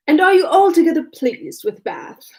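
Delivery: affected, high-pitched polite question; a lightly simpering society lilt. A narrator's reading. Man finishing his mock questions to his partner, simpering. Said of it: "with a simpering air".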